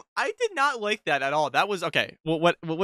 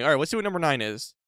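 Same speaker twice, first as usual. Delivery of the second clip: ordinary talk, nothing marked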